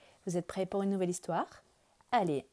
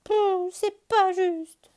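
high, loud, slow sulky complaint; sulking; 12 semitones higher, 8 dB louder, much slower than usual. Acted. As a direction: pout it out like a disappointed child. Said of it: "dit Lapin".

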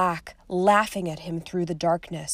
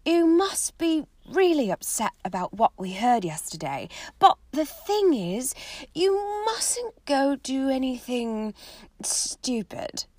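lightly voiced Hermione; 6 semitones higher than usual.

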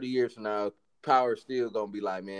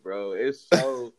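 ordinary talk, nothing marked

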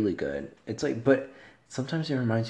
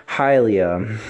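loud greeting; 9 dB louder than usual. Real, not acted. Greeting friend who texted.

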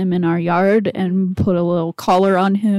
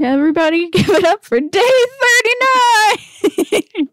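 hype announcer; 13 semitones higher, 4 dB louder than usual. Acted. Hyping up the podcast episode.

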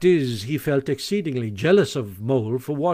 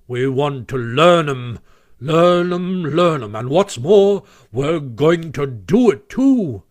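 loud, stubborn repetition; emphatic insistence; 2 semitones higher, 7 dB louder, slower than usual. A narrator's reading. Badger overrules Rat's grammar correction, emphatic. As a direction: hammer it home, slowing to finish.

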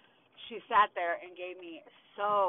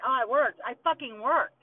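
loud, raised, indignant burst; exasperated anger; 6 semitones higher, 9 dB louder than usual. Real, not acted. Defending her work history to her listeners, loudly exasperated. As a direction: throw it out forcefully, higher and heated. Venting about being unfairly judged.

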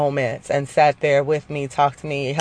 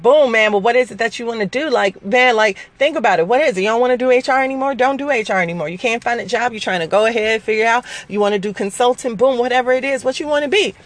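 higher, pushy big-sister coaching; sister's voice; 7 semitones higher, faster than usual. Retold or imitated. Sister grilling her about her career, high and insistent. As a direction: press her to name what she wants. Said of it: "so she was like".

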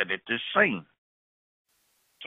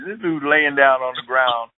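ordinary talk, nothing marked

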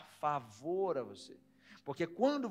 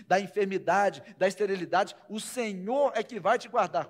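proclaimed blessing; 4 semitones higher, 9 dB louder than usual. Acted. (bênção declarada devagar)

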